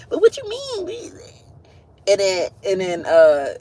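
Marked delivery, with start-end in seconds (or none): 0.1-0.9 mock [name]. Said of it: "back and forth like".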